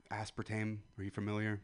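ordinary talk

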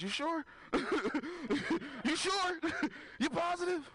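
high, rising mock disbelief; doubting his buddy's tattoo, high and incredulous. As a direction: ask it like you can't believe it. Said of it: "I said".

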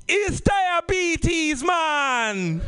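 slow, high mock-grave doctor delivering news; grim doctor. Doctor breaking bad news to patient, slow mock-grave voice.

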